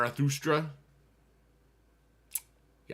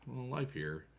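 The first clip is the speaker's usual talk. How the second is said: ordinary talk, nothing marked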